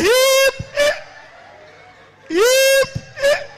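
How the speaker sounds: very high squealed stuck syllable; stammering teacher. Retold or imitated. Teacher stuck on hippopotamus, high squealed stammer. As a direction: squeal "hip" as a mock stammer block. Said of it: "he's a conch stammerer".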